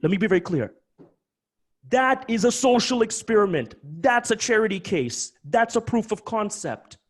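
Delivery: animated. Real, not acted. Host clarifying the license to brokers, loud and animated. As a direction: spell it out firmly. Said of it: "I'm just speaking animated".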